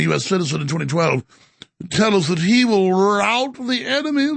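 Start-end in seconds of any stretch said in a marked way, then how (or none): 1.9-4.3 higher pompous mock British accent; a hint of a pompous royal; mock posh British. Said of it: "He's trying always to sound like Prince Charles".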